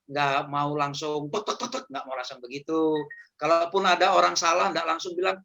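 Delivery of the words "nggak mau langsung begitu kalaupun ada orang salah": ordinary talk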